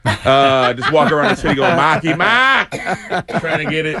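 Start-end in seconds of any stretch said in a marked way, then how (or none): 1.8-2.7 put-on Boston accent. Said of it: "I just say [name] a bunch".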